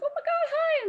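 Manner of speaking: very high, gushing mock fangirl greeting; starstruck gushing. Friend meeting Jason Momoa, starstruck gushing. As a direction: imitate a friend squealing hello to an idol.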